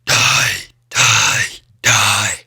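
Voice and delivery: low, rasping villain chant; Reaper impression. Quoting a hero shooter villain, low raspy Reaper impression. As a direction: growl each word separately, low and rough. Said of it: "the Reaper's".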